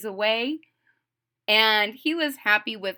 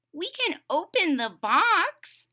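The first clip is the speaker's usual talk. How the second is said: higher, slow toad loophole; Toad's voice; 8 semitones higher, slower than usual. Acted. Toad pointing out a loophole to Frog, higher voice. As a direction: point out the easy way round.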